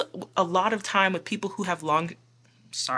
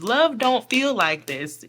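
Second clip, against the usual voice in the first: slow, louder, stress on love; emphatic; 5 semitones higher, 6 dB louder, slower than usual. Real, not acted. Reader urging viewers about love, slow and emphatic. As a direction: lean hard on love. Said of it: "emphatic advice to viewers".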